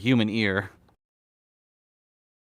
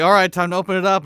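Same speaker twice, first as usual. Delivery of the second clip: excited call; 7 semitones higher, 5 dB louder, faster than usual. Acted. Acted quote in the cake story.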